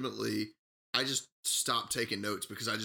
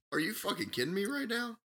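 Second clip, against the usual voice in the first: high, disbelieving sarcasm; incredulous; 8 semitones higher than usual. Real, not acted. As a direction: scoff at how dumb it is. Incredulous rant at fishing pole.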